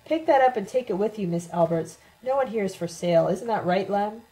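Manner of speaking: plain reading voice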